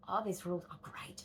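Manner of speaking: soft unvoiced whisper of glee; whispered delight. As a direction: breathe it like a happy secret.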